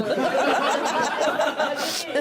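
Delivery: high, loud burst of laughter; real laughing fit. Real, not acted. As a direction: crack up helplessly. Laughing fit over the news.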